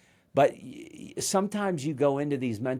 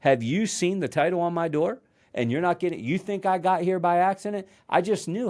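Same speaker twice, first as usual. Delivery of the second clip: higher-pitched, rising rhetorical questions; arrogant indignation; 7 semitones higher than usual. Retold or imitated. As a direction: imitate an affronted boss pulling rank. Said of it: "an arrogant mentor".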